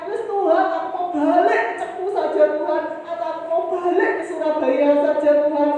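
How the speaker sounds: high, loud cry of surrender; giving up. Pretend. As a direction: voice an imagined plea to quit, loud and high. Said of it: "supaya saya menyerah dan saya angkat tangan".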